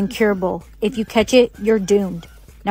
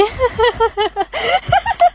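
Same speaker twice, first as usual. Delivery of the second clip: high uncontrolled giggle; 11 semitones higher than usual. Acted. Friend catches the disease.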